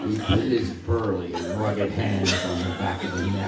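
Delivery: low, slow and over enunciating; slow sexy voice. Shown as a demonstration. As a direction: draw it out like a seduction. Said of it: "I'll say it slower".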